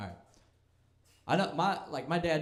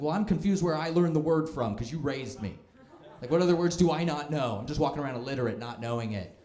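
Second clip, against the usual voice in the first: raised, higher voice in exasperation; mad yelling; 5 semitones higher, 4 dB louder than usual. Retold or imitated. Son yelling at mom over a word she never taught him, exasperated. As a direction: retell an indignant rant at mom. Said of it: "started yelling at her".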